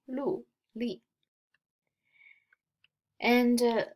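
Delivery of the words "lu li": "lu, li" each falling from high; fourth tone. Shown as a demonstration. Reading fourth-tone examples to students, falling "lu, li". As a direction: start high and drop on "lu" and "li". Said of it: "Fourth tone".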